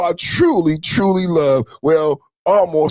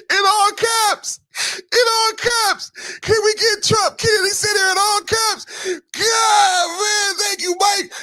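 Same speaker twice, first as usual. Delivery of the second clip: excited squeal; 13 semitones higher than usual. Real, not acted. Streamer begs the mod for all caps, squealing high. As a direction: plead for it through giddy laughter.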